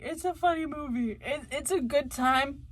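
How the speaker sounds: ordinary talk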